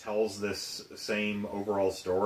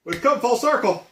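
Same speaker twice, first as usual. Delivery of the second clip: dramatic; 12 semitones higher, 8 dB louder than usual. Acted. Hammed-up cosmic ending.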